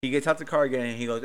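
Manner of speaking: ordinary talk, nothing marked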